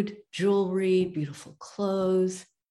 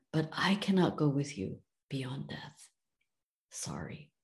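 hushed, lower, softer apology; whispered refusal; 3 semitones lower, 6 dB quieter than usual. Acted. Loyal second wife gently refusing to follow him beyond death, whispered. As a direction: let the refusal fall to a whisper.

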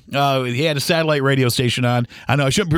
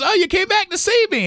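much higher, sing-song imitation of the waitress; sweet waitress voice. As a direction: greet them like a delighted old waitress. Waitress Gamma greeting them again.

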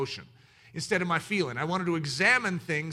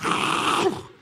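unvoiced laugh; 5 dB louder than usual. Real, not acted. Laughing at porters story.

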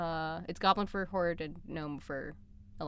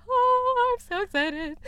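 re-enacted high, loud fan squeal; excited squeal; 7 dB louder, slower than usual. Fan re-enacting the reaction to the trailer, excited squeal. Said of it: "and I'm just like".

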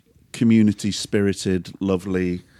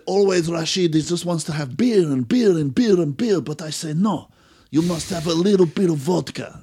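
higher, hammed-up Eastern European accent; mock Eastern European excuse; 8 semitones higher than usual. Neighbour explaining to the narrator why Rashid needed vodka, in a mock Eastern European accent. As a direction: play the drunk friend justifying the vodka.

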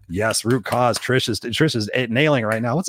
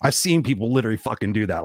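ordinary talk, nothing marked

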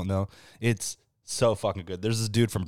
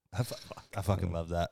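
ordinary talk

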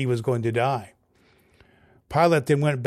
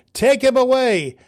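much higher, louder furious cry; crowd shout; 11 semitones higher, 6 dB louder than usual. A narrator's reading. Crowd shouting at Pilate, loud and high. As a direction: scream it at the governor. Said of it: "they shouted".

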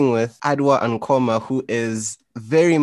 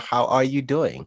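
ordinary talk, nothing marked

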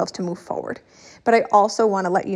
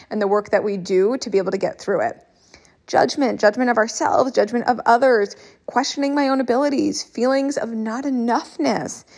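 ordinary talk, nothing marked

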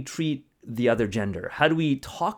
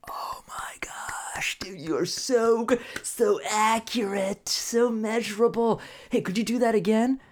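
awed gushing; 8 semitones higher, slower than usual. Acted. Awed onlooker praising the mathematician.

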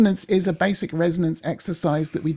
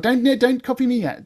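ordinary delivery

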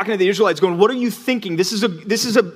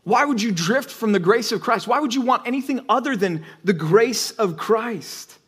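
incredulous Paul; 5 semitones higher than usual. Retold or imitated. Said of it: "he's like".

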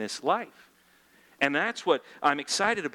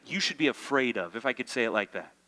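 ordinary delivery

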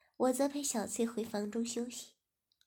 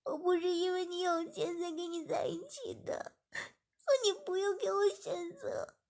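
tearful pleading; 8 semitones higher, 4 dB louder than usual. Acted. Girl pleads through tears.

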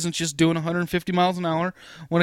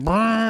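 rolled, buzzing vroom, pitched up; imitated car noise; 5 semitones higher than usual. Storyteller acting out the cop's car chase, imitated engine noise. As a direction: make a quick revving noise with the mouth.

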